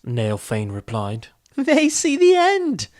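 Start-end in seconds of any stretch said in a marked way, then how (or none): 1.5-2.9 laughing. A narrator's reading. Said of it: "laughed Dinin".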